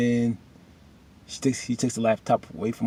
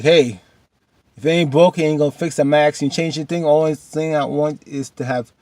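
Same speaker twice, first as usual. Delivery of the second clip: emphatic retold pastor; 4 semitones higher, 7 dB louder than usual. Pastor retelling meeting, emphatic quote.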